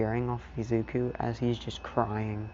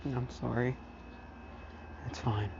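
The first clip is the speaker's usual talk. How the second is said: subdued; 10 dB quieter than usual. Retold or imitated. Subdued exchange after the crying.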